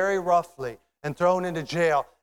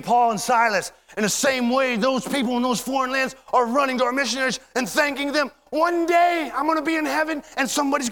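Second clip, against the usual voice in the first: high, loud, driving pulpit delivery; full-force preaching; 9 semitones higher, 5 dB louder than usual. Acted. Preacher picturing thanks in heaven, full-force preaching.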